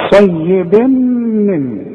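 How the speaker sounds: صيب من stretched very slowly, merged; drawn out. Shown as a demonstration. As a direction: drag out صيب من for the ghunna. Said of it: "شوف". (صيب من ممدودة ببطء شديد)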